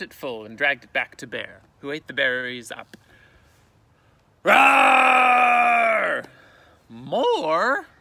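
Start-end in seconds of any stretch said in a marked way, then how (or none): 4.4-6.2 very loud, high, long roar; hungry bear roar. Acted. Bear roars again. 6.9-7.9 loud, high rising bear voice; a greedy beast demanding more; bear asking. Acted.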